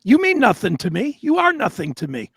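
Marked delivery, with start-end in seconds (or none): none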